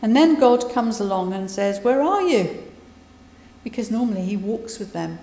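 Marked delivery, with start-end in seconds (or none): 1.8-2.6 higher, louder call into the garden; God calling for Adam and Eve, raised and higher. Retold or imitated. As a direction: call out searching for them.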